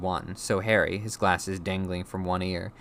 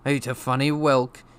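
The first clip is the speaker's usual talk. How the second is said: higher, louder character voice; Uncle Vernon; 6 semitones higher, 6 dB louder than usual. A narrator's reading. Uncle passing postcard news to his wife, loud announcing. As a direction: voice an uncle reporting news loudly.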